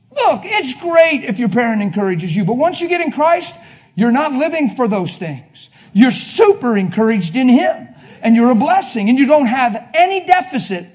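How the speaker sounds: loud, high pulpit preaching; preaching full force. Real, not acted. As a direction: preach it to the room.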